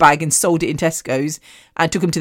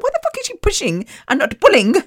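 high, squeaky inner outcry of disbelief; baffled outrage; 13 semitones higher than usual. Retold or imitated. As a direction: shriek it in your head, what is she doing.

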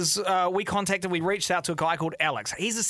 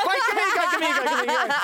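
laughing; 13 semitones higher, 4 dB louder than usual. Real, not acted. Laughing while passing the balloon.

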